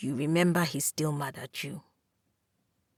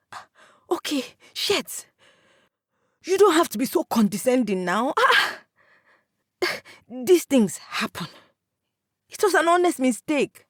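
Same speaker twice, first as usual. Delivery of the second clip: higher, pushing back, a bit hurt; defensive protest; 7 semitones higher than usual. A narrator's reading. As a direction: wave off the blame. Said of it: "and you protest".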